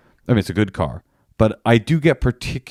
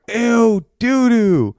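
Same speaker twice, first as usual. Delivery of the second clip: put-on high, loud childish disgust; mock disgust; 13 semitones higher, 3 dB louder, much slower than usual. Package thieves opening a nasty box, hammed-up disgust. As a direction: squeal it in comic revulsion.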